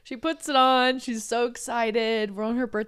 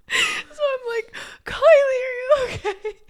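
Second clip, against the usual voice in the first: laughing to tears; 13 semitones higher, 4 dB louder than usual. Real, not acted. Telling friend about sister's crash, laughing to tears. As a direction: squeak it out through tears of laughter.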